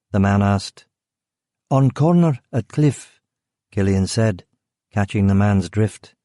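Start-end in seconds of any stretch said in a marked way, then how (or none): 1.7-3.2 put-on higher, broken-English Eastern European accent; vague Eastern European accent. Killer keeps up his foreign act with the guard, vague Eastern European accent. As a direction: play the clueless foreign driver.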